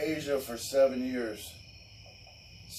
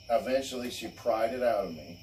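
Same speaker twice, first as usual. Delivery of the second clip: ordinary talk, nothing marked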